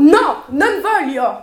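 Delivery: loud, slow refusal; defiant shout. Acted. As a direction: dig your heels in. Daughter refuses in quarrel.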